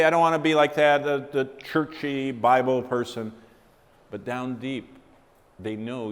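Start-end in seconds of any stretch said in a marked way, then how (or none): 0.0-3.3 higher, louder, fast scornful brush-off; mock dismissive quote. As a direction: scoff at the churchy Bible person. Mocking what unbelievers might say.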